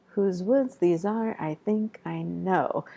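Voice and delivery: Whose woods these are, I think I know with every second syllable hit hard; da-DA beat, stress woods, are, think, know; overpronounced rhythm. Shown as a demonstration. Teacher exaggerating the iambs for the class. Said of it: "I'm pronouncing the rhythm there, or overpronouncing".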